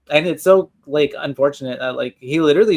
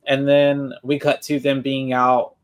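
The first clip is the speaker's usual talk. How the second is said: usual speaking voice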